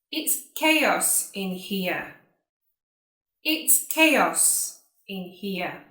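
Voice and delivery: slow, spaced-out words; repeat-after-me model. Shown as a demonstration. Teacher saying sentences for students to repeat, slow and careful. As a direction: say it slowly for learners to copy. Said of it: "like you to repeat after me".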